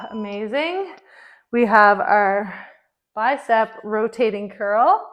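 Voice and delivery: loud, pumped-up trainer cues; workout coach calling. Acted. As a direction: call the reps over the music. Calls out the workout over music.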